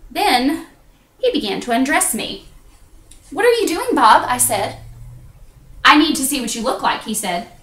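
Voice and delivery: measured, confessional stage delivery with pauses; theatrical monologue. Acted. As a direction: tell it as a solo stage confession.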